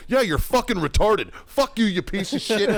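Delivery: high-pitched furious tirade; re-enacted raging outburst; retold screaming. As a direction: yell it back with contempt. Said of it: "I've never screamed so fucking much".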